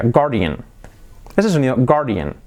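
guardian modelled as GAR-dee-an; pronunciación correcta. Shown as a demonstration. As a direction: say "guardian" with silent u. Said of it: "así sería". (guardian sin u, 'gár-dian')

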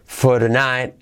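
"For tonight" with the t of "tonight" turned to a soft tapped r. Shown as a demonstration. Teacher showing students the t of "For tonight" said as a soft r. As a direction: tap the t of "tonight" like a light Spanish r. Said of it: "La T se hace como R suave".

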